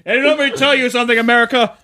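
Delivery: high loud campaign address; politician speech voice. Pretend. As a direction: address the nation like a candidate.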